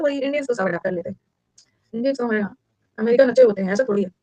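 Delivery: ordinary talk, nothing marked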